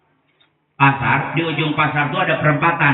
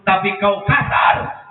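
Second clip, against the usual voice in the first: high slow angry shout; 5 semitones higher, slower than usual. Acted. Blind man scolds.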